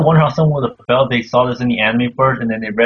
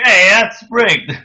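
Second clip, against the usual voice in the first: raised, drawn-out cry of foul; slow, higher, louder play-acted objection; mock protest; 7 semitones higher, 5 dB louder, much slower than usual. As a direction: call out the cheat in mock outrage. Voicing pirates protesting the result.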